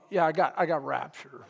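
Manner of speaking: low, dejected mock reply; reluctant, low-energy mumbled excuse; unenthused reluctance. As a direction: play it glum, like the rapture is a chore. Acting out reluctant response to rapture.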